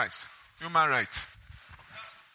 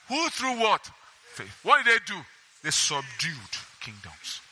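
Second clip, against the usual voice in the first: ordinary talk, nothing marked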